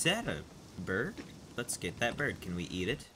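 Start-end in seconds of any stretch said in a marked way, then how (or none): none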